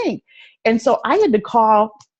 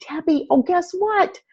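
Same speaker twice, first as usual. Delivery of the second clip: eager friend; 10 semitones higher, slower than usual. Retold or imitated. Friend's eager news retold.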